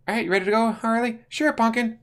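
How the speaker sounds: high put-on villain voices; Joker and Harley. Joker fetching Harley, high put-on voices. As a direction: play the couple leaving together.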